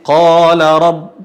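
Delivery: QALA with a thick, drawn-out "qaa"; thick long alif. Shown as a demonstration. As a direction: recite qala slowly, heavy rounded first vowel. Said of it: "Dan tebal. La, sama seperti". (qala dibaca tebal dan panjang)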